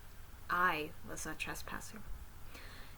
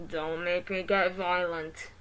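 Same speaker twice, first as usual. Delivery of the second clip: robot voice; 5 dB louder, slower than usual. Acted. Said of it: "it said".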